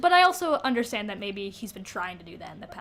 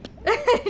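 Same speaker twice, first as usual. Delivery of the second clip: high laugh; 13 semitones higher, 6 dB louder than usual. Real, not acted. Laughing at the scene.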